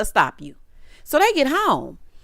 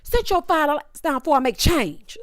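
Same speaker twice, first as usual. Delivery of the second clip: mock bossy demand; 6 semitones higher than usual. Imitating a housemate ordering a man to sit, high. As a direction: lay down the order, laughing. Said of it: "Here go Bria".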